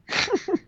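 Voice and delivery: high short laugh. Real, not acted. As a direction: burst out laughing. Laughing at headline.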